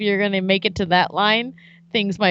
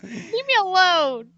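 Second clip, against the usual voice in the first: high-pitched whiny imitation; mock protest; 11 semitones higher, slower than usual. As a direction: mimic a put-upon friend, pitched way up. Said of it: "You're like".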